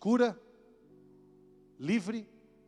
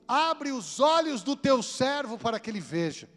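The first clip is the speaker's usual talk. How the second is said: slow, raised preaching plea; fervent prayer; 5 semitones higher, slower than usual. Retold or imitated. Elisha praying to God for his servant, fervent plea. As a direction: implore God with drawn-out, ringing words. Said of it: "Eliseu está falando para Deus".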